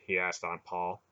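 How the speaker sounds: ordinary delivery, nothing marked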